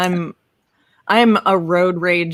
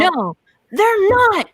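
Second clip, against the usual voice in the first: high, drawn-out exasperated contradiction; slow, high-pitched insistence; emphatic denial; 10 semitones higher, slower than usual. Real, not acted. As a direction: flatly refuse it, stretching the words up high. Emphatic disagreement about differing laws.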